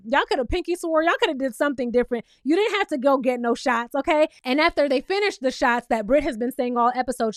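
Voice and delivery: ordinary talk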